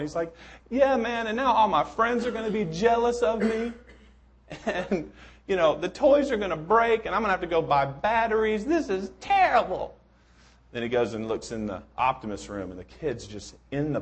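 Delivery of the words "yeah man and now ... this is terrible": put-on high, whining boy griping about his toys; squeaky complaining child; whiny kid voice. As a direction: whine like a spoiled kid. Pessimist kid complains in story.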